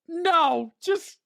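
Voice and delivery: high, slow fed-up dismissal; mock dismissal. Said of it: "I was like".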